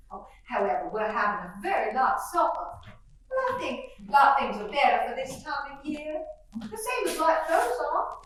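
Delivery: gushing genteel belle, chattering; lightly voiced Southern mother greeting the guest; fluttery Southern mother. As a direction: play the chatty Southern hostess.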